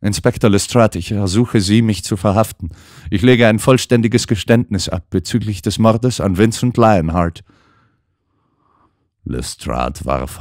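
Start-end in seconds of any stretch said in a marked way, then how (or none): 0.0-7.4 lightly voiced young actress, rising to a pleading cry; an actress insisting on her guilt, growing desperate; actress confessing. A narrator's reading. Actress demands her own arrest. (Schauspielerin gesteht, zunehmend verzweifelt)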